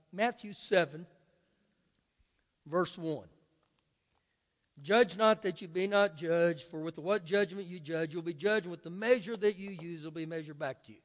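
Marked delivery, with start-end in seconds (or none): 4.8-11.0 brisk, run-on scripture reading; read fast. Shown as a demonstration. Pastor reads the verse to the congregation, deliberately fast. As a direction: rattle the verses off quickly. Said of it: "Man, you read that fast".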